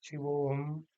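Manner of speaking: शिवोहम in one go with a held वो; शिवोहम as one sustained word; all together. Shown as a demonstration. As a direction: join शिवोहम, lengthening the o. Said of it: "एक साथ".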